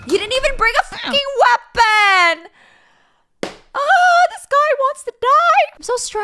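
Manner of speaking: high, loud disbelieving yell; freaked-out yelling; exasperated shout. Real, not acted. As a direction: shout at the screen in frustration. Shouting at horror video.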